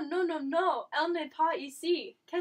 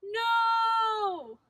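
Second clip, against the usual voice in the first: loud, high cry of alarm; shouting no; 6 semitones higher, 4 dB louder than usual. Acted. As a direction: yell it in alarm. Friend seized by villain.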